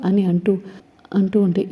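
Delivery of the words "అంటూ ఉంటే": plain reading voice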